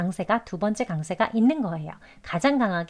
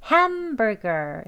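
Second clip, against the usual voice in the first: "hamburger" slowly, HAM long and strong; main stress on HAM; much slower than usual. Shown as a demonstration. Teacher putting the word together, "hamburger" stressed on HAM. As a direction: lean on HAM in "hamburger", weaker "burger". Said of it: "ham이 가장 또 강하게".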